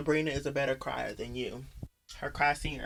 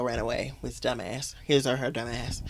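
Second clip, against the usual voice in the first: ordinary talk, nothing marked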